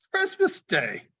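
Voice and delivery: put-on a child shouting up; boy's voice. The boy answering Scrooge, high-pitched.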